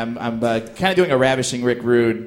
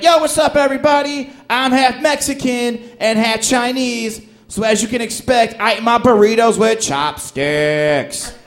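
high, loud hype delivery; hammed-up comedian working to a punchline; formulaic stand-up comic; 9 semitones higher, 4 dB louder than usual. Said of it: "Next guy came on stage, he's like".

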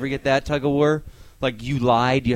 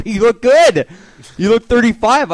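higher, louder, over-eager praise; gushing compliment; 9 semitones higher, 10 dB louder than usual. Pretend. A stranger flattering the comic about his age, gushing. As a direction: lay the flattery on thick.

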